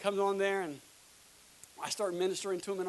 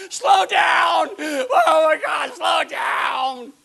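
drawn-out, high full-voiced yell; screaming; 9 semitones higher, 17 dB louder, much slower than usual. Church members overwhelmed by the pace, hammed-up screaming. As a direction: scream it long and loud, pleading. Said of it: "you'll be screaming".

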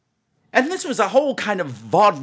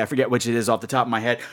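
ordinary delivery

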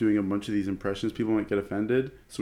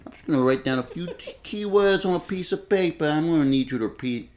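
put-on mafia-boss offer in Italian accent; 4 semitones higher than usual. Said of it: "my Italian accent".

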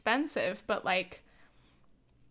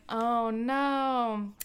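dismayed groan. Retold or imitated. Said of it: "And I was like".

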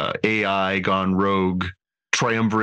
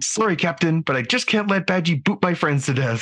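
higher, earnest apology; hammy stage actor; 9 semitones higher than usual. Retold or imitated. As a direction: overplay a sorry crewman. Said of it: "from Rutherford".

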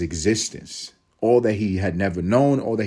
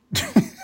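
high laughing squeal. Retold or imitated. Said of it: "that was my grandma when she's had it".

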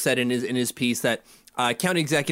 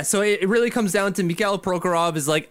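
ordinary talk

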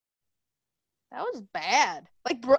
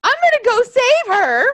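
heroic mock cry; 6 semitones higher, 6 dB louder than usual. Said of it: "I'd be like".

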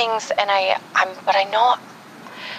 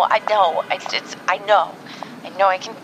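usual speaking voice

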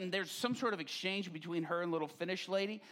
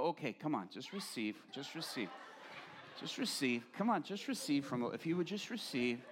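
lightly voiced soothing lull, softer; coaxing someone down like a lullaby; lullaby pray-er; 3 semitones lower, 4 dB quieter than usual. Pastor coaxing a prayee down, soft mock lullaby. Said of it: "imagine them sort of humming a lullaby".

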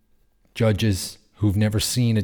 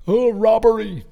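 much higher and louder mock whine; 12 semitones higher, 6 dB louder than usual. Mocking fans who moan online about a robbery, high and loud. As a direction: sneer at the online moaners, up high. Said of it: "people are just gonna be moaning online".

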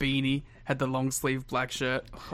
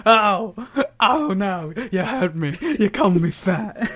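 loud, higher, laughing fake hurt; laughing Dusty whine, much louder; mock hurt Dusty; 6 semitones higher, 8 dB louder, slower than usual. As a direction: ham up wounded feelings while laughing. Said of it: "And then Dusty's like".

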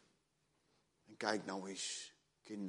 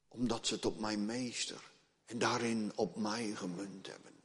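ordinary talk, nothing marked

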